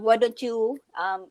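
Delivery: ordinary talk, nothing marked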